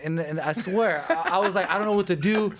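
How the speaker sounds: high, loud, panicked retelling of being scared; panicky fear. Retold or imitated. Fighter retelling sea urchin scare to teammates, high panicked voice. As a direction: relive the terror of thinking he's dying.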